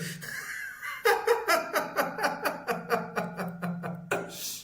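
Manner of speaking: long, high wheezing laugh; helpless wheezy laughing fit; real laughter. Real, not acted. Laughs on at own story.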